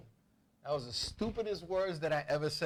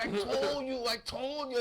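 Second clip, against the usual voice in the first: high, repetitive mock nagging; drunk father; 6 semitones higher than usual. Father repeating himself to the kids, high mock insistence. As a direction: imitate a boozy dad insisting he was right. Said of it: "he used to be like".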